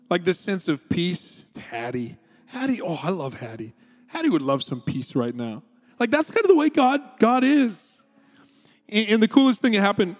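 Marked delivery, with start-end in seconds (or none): 1.5-5.6 soft, low and fond; tender God voice. Retold or imitated. God thinking of Hattie, soft tender murmur. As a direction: murmur her name with love. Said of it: "God's like".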